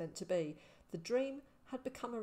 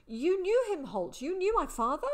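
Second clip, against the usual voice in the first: much higher and louder in sudden disbelief; astonished boy; 11 semitones higher, 9 dB louder than usual. A narrator's reading. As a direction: blurt it out, stunned and hopeful. Boy realising Holt knew father.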